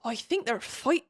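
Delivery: a hint of Hagrid's West Country accent for Riddick. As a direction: lightly put on Hagrid accent, cut off. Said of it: "why does he sound like Hagrid?".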